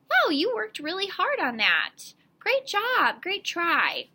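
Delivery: high, loud, impressed praise for effort; encouraging praise. Acted. Teacher acknowledging a struggling young reader, encouraging praise. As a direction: praise them with big, rising-and-falling enthusiasm. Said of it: "just acknowledge them".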